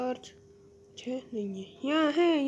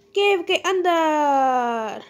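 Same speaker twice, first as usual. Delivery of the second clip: slow, louder drawn-out call; dramatic announcement; 3 semitones higher, 8 dB louder, much slower than usual. Real, not acted. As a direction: draw it out like a trailer voice. Announcing cave dive.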